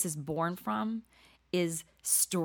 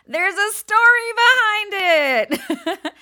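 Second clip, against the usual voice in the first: high, slow, loud and laughing; laughing punchline; 12 semitones higher, 10 dB louder, much slower than usual. Real, not acted. Speaker to audience, laughing through the tattoo punchline. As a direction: stretch it out through a big laugh.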